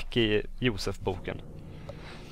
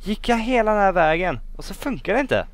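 high-pitched, indignant protest; incredulous outcry; 8 semitones higher, 5 dB louder than usual. Real, not acted. Player walked all that way for nothing, high incredulous complaint. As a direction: start loud and high, stay high to the end. Machine fails after long trek.

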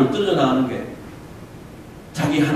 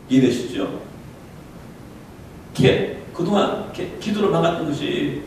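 ordinary talk

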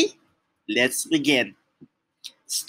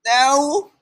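emphatic refusal; 9 semitones higher, 4 dB louder than usual. Pretend. Answering plea to stop reading.

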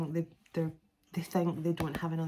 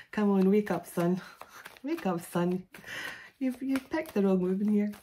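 mock Scottish God, loud scolding; a booming Scottish voice of God telling someone off; playful put-on Scottish accent, louder; 2 semitones higher, 4 dB louder than usual. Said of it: "I don't think God's got a Scottish accent".

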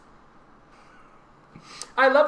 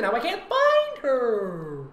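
mock scream; 6 semitones higher, much slower than usual. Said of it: "I heard her screaming".

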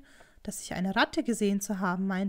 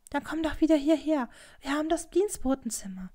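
higher, coaxing plea; imploring, in a raised pitch; pleading; 6 semitones higher than usual. A narrator's reading. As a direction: coax him, almost whining. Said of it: "mit flehentlichem, fast winselndem Ton".

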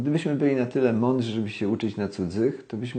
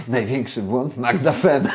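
laughing; 6 semitones higher, 4 dB louder than usual. Real, not acted. Laughs naming his biggest mistake.

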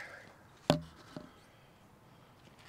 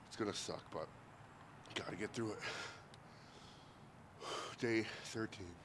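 normal talking voice

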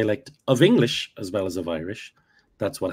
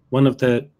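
ordinary talk

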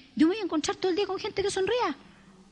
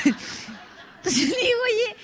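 laughing; 6 semitones higher, much slower than usual. Real, not acted. Speaker laughs while retelling.

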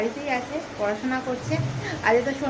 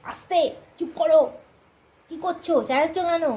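mock family scolding; 5 semitones higher than usual. Family imagined scolding the vlogger for chattering, mock annoyed hush. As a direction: pretend to be the sleeping family telling her to hush. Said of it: "এইসব বলবে".

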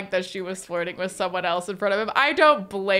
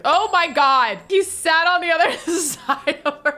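laughing shock; 7 semitones higher, 6 dB louder than usual. Real, not acted. Shocked by love triangle.

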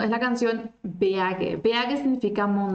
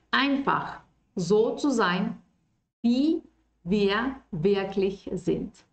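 slow model line; much slower than usual. Shown as a demonstration. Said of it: "Wie, como".

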